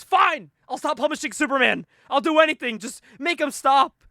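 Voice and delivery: high, loud frantic pleading; panicked surrender. Retold or imitated. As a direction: cave in with hammed-up panic. Terrified boss gives in.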